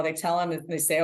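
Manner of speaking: usual speaking voice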